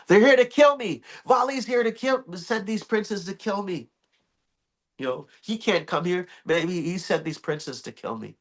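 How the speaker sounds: high, rushed fearful worry; a touch of frightened panic; panicky fear. Acted. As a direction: Sugriv fretting that princes came to kill him. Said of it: "he's thinking, "Oh my God".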